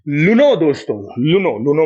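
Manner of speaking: लुनो with an L instead of S, a mock baby lisp; playful lisping सुनो. Teacher teasing students, lisping लुनो for सुनो. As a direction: say लुनो, swapping the S of सुनो for L, cutely. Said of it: "लुनो मतलब सुनो भाई".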